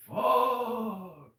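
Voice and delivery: high, loud despairing cry; panicked yell. Pretend. Friend imagines him caught by police, yelling in panic. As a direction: let the panic burst out. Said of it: "you're like".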